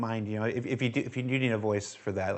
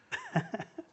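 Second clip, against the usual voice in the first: high laugh; 6 dB quieter than usual. Real, not acted. Laughing at chat.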